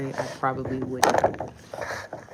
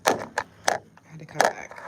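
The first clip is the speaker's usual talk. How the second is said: ordinary talk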